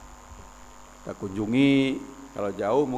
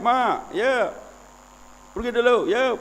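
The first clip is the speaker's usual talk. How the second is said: light mock yell, pitched up high; mild retold call-out; 9 semitones higher than usual. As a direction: imitate calling out to mom from afar. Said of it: "saya teriak".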